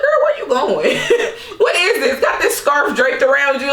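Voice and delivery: high-pitched, laughing mock challenge; amused disbelief. Host replays her reaction to Karen's airport chic, laughing disbelief. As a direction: question the look through giggles.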